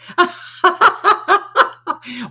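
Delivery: high bursting laugh; big laugh. Real, not acted. Laughing hard at the radio channel name, big laugh. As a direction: laugh out loud at the joke.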